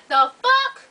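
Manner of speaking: high, punchy "The fuck?"; mock high-pitched outrage; shocked outburst. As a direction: act suddenly offended. Mimi reacts in shock.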